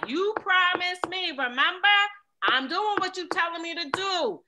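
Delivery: high, loud and slow, begging God; a woman pleading out loud with God. Retold or imitated. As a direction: plead loudly, near desperate. Acting out talking to God, pleading.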